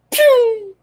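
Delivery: high sharp gunshot pew; gunshot noise. Retold or imitated. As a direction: fire off a quick high pew. Imitating a gunshot sound.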